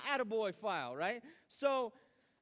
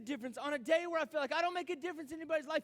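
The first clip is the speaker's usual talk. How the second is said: relieved outburst; 6 semitones higher, 6 dB louder than usual. Retold or imitated. Reading a note, voicing his doubts.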